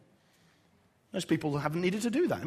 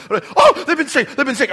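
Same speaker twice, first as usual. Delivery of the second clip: much higher and louder, frantic repeating; hysterical panic; 9 semitones higher, 12 dB louder than usual. Retold or imitated. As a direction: flap around in a hammed-up panic. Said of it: "I am completely hysterical".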